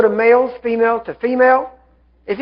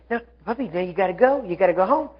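soft pleading with a puppy; gentle coaxing; 5 dB quieter than usual. Retold or imitated. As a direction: urge a little dog home, quietly and kindly. Lady coaxing the dog again.

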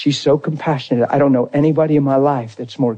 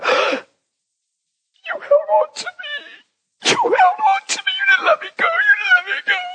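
much higher, shouted and stretched out, a desperate outcry; anguished cry. Retold or imitated. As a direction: cry it out as if clinging on for life. Wife crying out to husband.

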